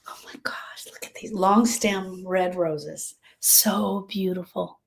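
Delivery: hushed, slow, breathless delight; awed whisper. Retold or imitated. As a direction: whisper it like you can't believe the roses. Swooning over roses.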